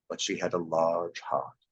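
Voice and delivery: low, soft and slow; a solemn spirit speaking gently; ghost's voice. A narrator's reading.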